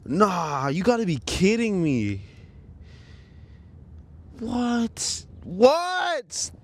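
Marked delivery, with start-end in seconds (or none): 4.4-6.5 incredulous. Real, not acted. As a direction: stretch each one in rising disbelief. Cannot believe it broke.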